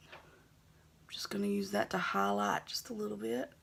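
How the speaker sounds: soft unvoiced aside while working; whisper. Real, not acted. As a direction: whisper it to yourself as you brush. Makeup tutorial aside, whispered.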